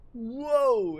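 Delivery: high, loud whoa; mock surprised whoa. Friend bolting off to smash trees, with a high, loud mock whoa. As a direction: yelp it like a startled friend.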